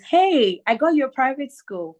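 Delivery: high, calling hey as dad; eager announcement. Retold or imitated. Dad announcing a private school to daughter, eager. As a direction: imitate dad bringing big news.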